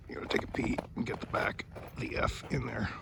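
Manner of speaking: fast hushed whisper. Real, not acted. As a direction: whisper quickly in the dark. Whispering at night in the cold.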